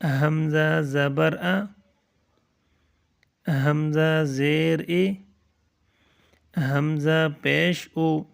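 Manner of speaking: each syllable drawn out with long pauses; slow drilled recitation. Shown as a demonstration. Teacher reciting slowly to children learning letters. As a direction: spell it out slowly for the class. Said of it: "یہ اس طرح پڑھیں گے۔".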